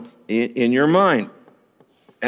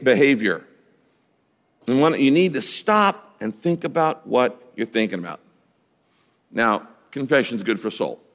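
ordinary talk, nothing marked

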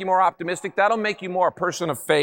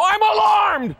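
much higher, louder startled cry; mock alarm; 12 semitones higher, 4 dB louder, slower than usual. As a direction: play it as a jolted sleeper.

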